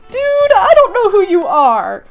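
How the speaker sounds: high, loud, incredulous retort; incredulous protest. Retold or imitated. Her to the pushy drunk stranger, incredulous protest. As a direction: push it up high, baffled by a stranger.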